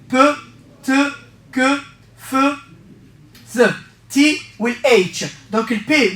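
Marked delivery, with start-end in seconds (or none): 0.1-3.7 one sound at a time, long pauses between; syllable-by-syllable drill. Shown as a demonstration. Teacher drilling the memory trick, each sound set apart. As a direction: isolate each syllable for learners to copy. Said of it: "عاود معايا".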